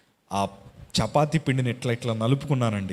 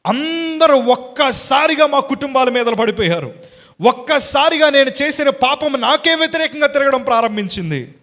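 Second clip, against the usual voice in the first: much higher and louder ringing proclamation; preaching at full force; 12 semitones higher, 8 dB louder than usual. Acted. Preacher testifying to the church, ringing loud cry. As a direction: proclaim it to the back row.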